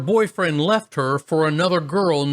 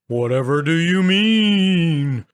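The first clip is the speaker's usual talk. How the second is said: ghostly voice; 2 semitones higher, slower than usual. Retold or imitated. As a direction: feign innocence in a spooky voice. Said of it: "Me, still ghostly".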